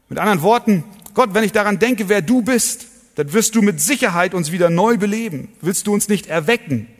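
high, loud, driving sermon voice; fervent preaching. Acted. Pastor urges his congregation to pray for revival, fervent preaching. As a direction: pour it out with full conviction.

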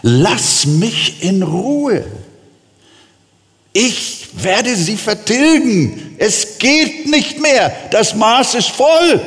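God telling Moses he has had enough, slow and lofty; voice of an angry God. Acted. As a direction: speak as God whose patience is at an end. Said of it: "Gott sagt zu Mose".

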